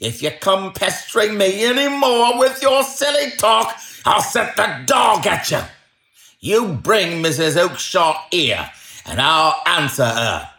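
high-pitched, forceful salesman's tirade; angry shouting. A narrator's reading. Salesman warning off a pestering man, shouting angrily. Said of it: "he shouted".

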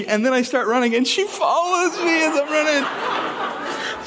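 high, slow, breaking into laughter; can't get through it without laughing; laughing while telling. Real, not acted. Dad laughing as he recounts being chased, high and slow.

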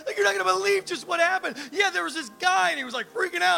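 buddy, breathless with wild news, fast and loud; excited retelling. Retold or imitated. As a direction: gush like you can't believe what happened. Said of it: "coming back from the towns going".

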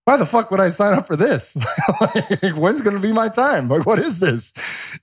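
dad retelling his son's beating, laughing in disbelief. Retold or imitated. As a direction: laugh through the complaint, throwing up your hands. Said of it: "And I'm like".

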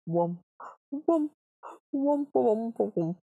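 hummed whooms, swooping up and down; imitating whimsical music. Shown as a demonstration. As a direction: sing the odd music as whooms. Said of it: "It was very, like".

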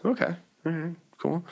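high, light startled reply; a hint of surprised agreement; mock surprise. Retold own surprised reply.

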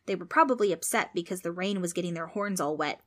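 ordinary delivery, nothing marked

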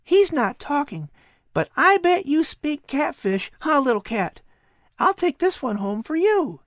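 put-on high, eager boy voice; a curious boy talking to a cat; young boy Patrick. Boy Patrick wondering aloud to the cat, high and eager.

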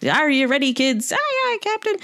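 high-pitched cartoon pirate accent call and answer; mock SpongeBob intro pirate accent. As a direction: imitate a pirate captain, then squeal the children's reply. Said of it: "accent that's in the SpongeBob intro".

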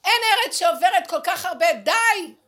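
fed-up cry of enough; exasperated shout. Acted. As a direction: shout it out, completely worn out. Voicing the land's exhaustion.

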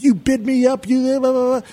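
high-pitched retold angry rant; mock yelling. Telling how he accused his rival bidder, mock yelling. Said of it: "screaming at him and yelling at him".

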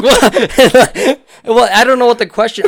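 high, loud incredulous what; hammed-up shocked what; mock surprise. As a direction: squeak it out in disbelief. Incredulous reaction to wrong answer.

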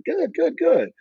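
high, eager, tumbling answer; flustered reply. Retold or imitated. Rookie answering the owner, high flustered eagerness. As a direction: blurt it out like a nervous kid.

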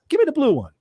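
very high, imitated demand; Les Brown. Les picks a bandana from the speaker, high-pitched demand. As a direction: pitch it way up, as a playful order. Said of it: "And he goes".

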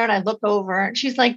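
usual speaking voice

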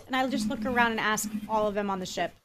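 ordinary talk, nothing marked

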